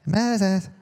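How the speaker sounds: thin weak call of Moses; a lightly done weak God voice. Shown as a demonstration. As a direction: try God as feeble and weak. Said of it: "A weak, maybe?".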